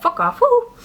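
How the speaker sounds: sudden high yelp of a vocal tic; involuntary tic outburst mid-story. Real, not acted. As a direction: let the tic burst out high. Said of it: "That tick I still do to this day".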